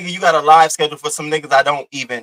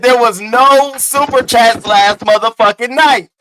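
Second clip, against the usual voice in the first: higher, louder and slow, hammering each word; emphatic loud repeat; 7 semitones higher, 7 dB louder, slower than usual. Real, not acted. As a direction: shout it again so nobody misses it. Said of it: "I said".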